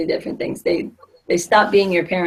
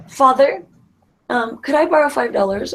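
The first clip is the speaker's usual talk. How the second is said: put-on politely formal child's request, pitched higher; mock formal request; 6 semitones higher than usual. Child asking father to lend money, mock formal. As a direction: ask Father for a favor, a touch too properly. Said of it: "That sounds a little, a little formal".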